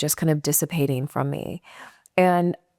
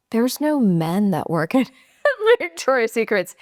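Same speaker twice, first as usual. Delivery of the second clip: laughing friend; 5 semitones higher than usual. Retold or imitated.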